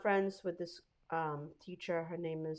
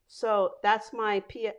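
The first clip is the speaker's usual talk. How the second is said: ordinary talk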